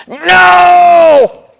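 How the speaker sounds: high, loud playful shout; hammed-up storybook no; loud mock no. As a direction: shout the answer big for the kids. Emphatic answer in story reading.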